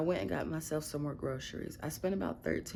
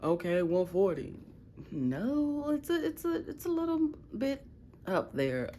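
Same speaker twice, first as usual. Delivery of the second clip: coworkers guessing; 6 semitones higher than usual. Retold or imitated. Coworkers guessing her weight.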